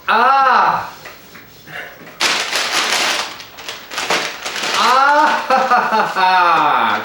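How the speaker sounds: loud high excited "Ah!"; excited loud exclaim. Real, not acted. Excited cry over the burger.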